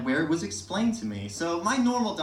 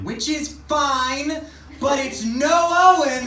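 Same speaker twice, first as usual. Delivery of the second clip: higher, louder, slow sing-song nurse; gushing nurse; 11 semitones higher, 8 dB louder, much slower than usual. Retold or imitated. Nurse telling the comedian his name beats her son's. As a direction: stretch it out like a smitten nurse.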